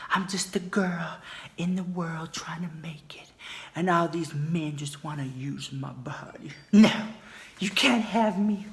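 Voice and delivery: slow, hushed, pretend-innocent girl; mock damsel whisper. Joking to viewers, mock coy whisper. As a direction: play the coy victim, slowly.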